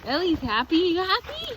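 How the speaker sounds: high, loud talk to a dog; excited pet talk. Real, not acted. Owner checks on her happy dog, excited high voice. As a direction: squeal it happily at the dog.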